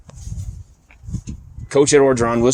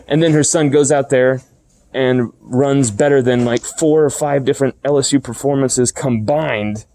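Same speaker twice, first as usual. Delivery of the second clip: normal talking voice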